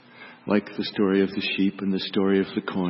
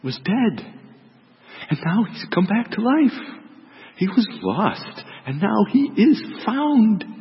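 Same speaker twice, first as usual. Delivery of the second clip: gentle, slow, lifting higher on the good news; tender plea; 10 semitones higher than usual. Acted. Father gently pleads with son.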